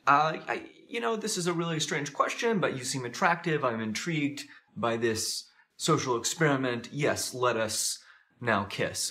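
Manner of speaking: hint of an oddly formal girl accepting; formal, strange girl. Imagined girl agreeing to kiss a stranger, lightly formal and strange. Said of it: "she was so formal and strange".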